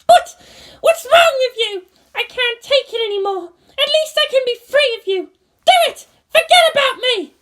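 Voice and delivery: high, loud desperate screaming; a girl shrieking in despair; furious pilot girl. Acted. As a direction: rage at him to give up. Asuka screaming.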